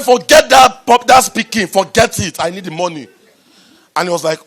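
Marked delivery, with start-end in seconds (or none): 0.0-3.1 loud dismissive insistence. Retold or imitated. Speaker retells waving off the panel to ask for money, loud. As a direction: brush it aside and insist. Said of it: "I said".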